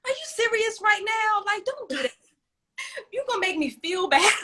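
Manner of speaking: high-pitched, exasperated pleading; incredulous parent. Acted. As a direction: plead in disbelief with a dramatic child. Parent reacts to child's wailing.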